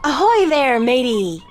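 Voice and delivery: loud hammy pirate hail; pirate greeting. Pretend. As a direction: call out like a cartoon pirate.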